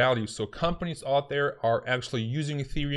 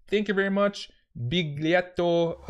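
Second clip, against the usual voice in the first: high, slow hammed-up Italian accent; put-on Italian accent, stretching the name; 7 semitones higher, 4 dB louder than usual. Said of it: "I will stop doing an Italian accent".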